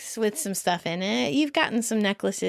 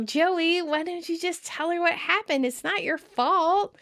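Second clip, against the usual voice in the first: a touch of a forced, pitched-up smiley voice; fake smile; 8 semitones higher than usual. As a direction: sound cheerful while faking it. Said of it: "Rachel with her fake smile".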